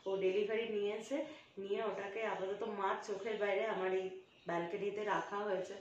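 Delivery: conspiratorial hush, planning a surprise; hushed secret talk. Real, not acted. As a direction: keep it very soft so mom can't hear. Hiding surprise gift from mother. (মায়ের কাছে লুকিয়ে, খুব নিচু গলায় ফিসফিসে কথা)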